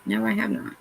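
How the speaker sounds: normal talking voice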